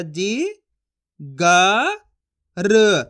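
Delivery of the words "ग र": ग and र said apart, र louder; letter by letter. Shown as a demonstration. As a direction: sound out each letter separately. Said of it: "एक एक अक्षर का उच्चारण करके पढ़ रहा हूँ".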